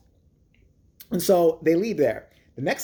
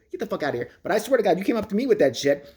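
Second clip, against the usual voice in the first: ordinary talk, nothing marked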